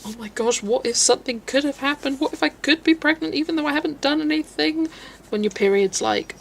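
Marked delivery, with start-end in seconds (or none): 0.0-4.9 spiraling worry, pitch climbing steadily higher; mock fearful. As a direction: act out a young girl's growing panic. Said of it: "we all had that fear when we were younger".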